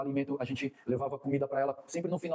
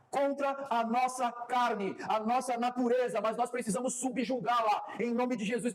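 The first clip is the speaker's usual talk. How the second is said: raised-pitch, driving sermon delivery; fervent preaching; 8 semitones higher than usual. Real, not acted. Preacher calling the church to subjugate the flesh, fervent preaching. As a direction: declare it to the whole church with force.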